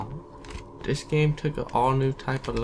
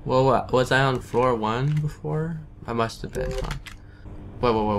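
normal talking voice

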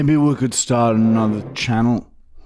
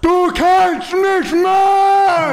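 put-on old man German; much slower than usual. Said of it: "like an old man German".

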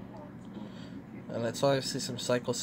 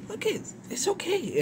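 high soothing coo. Real, not acted. Soothing a trembling dog.

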